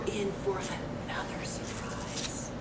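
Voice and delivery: suspenseful storybook whisper. A narrator's reading. Reading to children, whispering the coming surprise. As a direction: lean in and whisper it.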